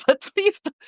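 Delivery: laughing nervously through it; flustered little laugh. Real, not acted. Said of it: "that's a nervous laugh".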